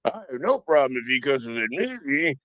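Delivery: lightly mocked officers. Host replays the officers' excuses to viewers, lightly mocking. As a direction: imitate the officers, sinking into a low register by the end.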